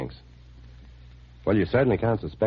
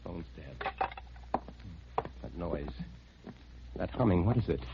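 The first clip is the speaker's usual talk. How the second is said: hushed dread; 10 dB quieter than usual. Acted. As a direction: breathe it out to yourself. Alone, phone dead.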